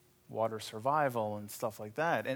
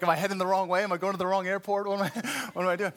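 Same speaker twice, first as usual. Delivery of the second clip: higher, louder, fast flustered questions; panicked student; 5 semitones higher, 4 dB louder, much faster than usual. Retold or imitated. Student pilot panicking under the instructor, flustered and rushed. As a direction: rattle off worried questions, laughing at the end. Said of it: "I'm like".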